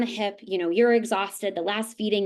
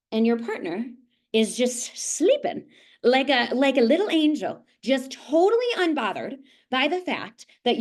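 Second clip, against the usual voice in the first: mock sweet; 5 semitones higher than usual. Real, not acted. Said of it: "mock sweet exasperation".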